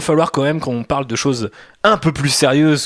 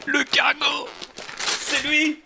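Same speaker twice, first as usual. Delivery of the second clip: high hushed voice; 13 semitones higher, 5 dB quieter, much slower than usual. Pretend. ASMR unwrapping gift, hushed.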